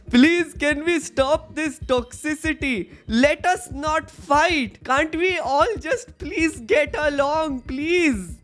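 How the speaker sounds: high, whiny peacemaker plea; pleading relative voice. Retold or imitated. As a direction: beg everyone to stop fighting. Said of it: "end every political conversation with".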